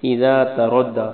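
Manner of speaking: اذا تردی drawn out slowly, the ra heavy; slow tajweed recitation. Shown as a demonstration. Teacher shows the students how to recite, slow and heavy. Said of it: "را کو موٹا پڑھنا ہے".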